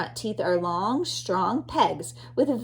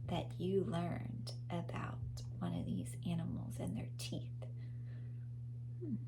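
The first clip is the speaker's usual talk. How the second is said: whisper; 2 semitones lower, 16 dB quieter than usual. Real, not acted. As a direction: gently coax an answer. Asking child about the animals.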